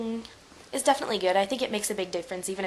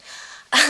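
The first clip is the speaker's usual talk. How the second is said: short laugh; 7 semitones higher, 6 dB louder than usual. Real, not acted. Laughs at own joke.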